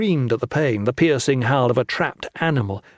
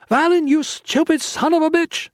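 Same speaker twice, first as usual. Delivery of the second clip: angry shout. A narrator's reading. Lin cursing Valin from the door, angry yell. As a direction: scream at him in fear and anger. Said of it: "fear in her voice now, fear and anger".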